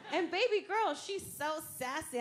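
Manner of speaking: ordinary talk, nothing marked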